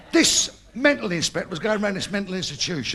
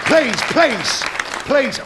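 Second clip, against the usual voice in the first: louder, raised pleading over the crowd; 6 semitones higher, 6 dB louder, much slower than usual. Real, not acted. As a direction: beg the laughing crowd to settle. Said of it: "calming audience".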